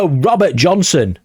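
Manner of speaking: normal talking voice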